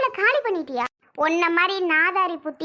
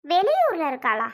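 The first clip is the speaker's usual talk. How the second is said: ordinary delivery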